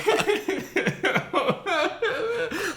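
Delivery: high whimpering laugh-cry; half laugh, half mock sob; high wailing giggle.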